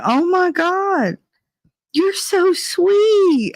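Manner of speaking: put-on high, slow, sugary old-lady coo; gushing old lady. Old couple at the store door praising the teen: high slow gushing coo. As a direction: coo it like a delighted granny, drawn out.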